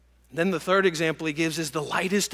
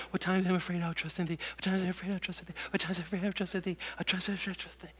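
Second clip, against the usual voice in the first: fast, soft, panicked rattling of the verse; boy in bed reciting the verse, fast and soft; frightened rapid recitation; 9 dB quieter, much faster than usual. Retold or imitated. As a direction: gabble it under your breath like a scared kid.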